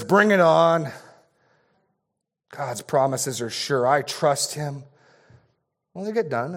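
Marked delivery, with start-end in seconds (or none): 0.0-4.8 louder, bold, sure-of-himself friend; confident friend. Acted. Friend reassuring the nervous father, bold and confident. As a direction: brush off the fear with firm trust. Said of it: "To which his friend says".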